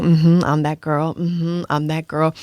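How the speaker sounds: low, slow, self-assured murmur to herself; quietly smug, savouring the moment; confident inner voice. Retold or imitated. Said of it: "and I'm going".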